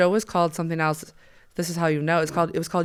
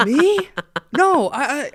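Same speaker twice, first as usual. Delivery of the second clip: high, loud, flustered protest; mock confusion; 10 semitones higher, 5 dB louder, much slower than usual. Audience man accused of wanting to kill her, mock flustered. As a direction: imitate a startled man put on the spot. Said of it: "like, so confused".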